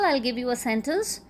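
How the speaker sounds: usual speaking voice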